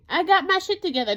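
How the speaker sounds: high, loud, defiant brag. Real, not acted. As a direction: throw it back at them, loud. Defiant rant continues.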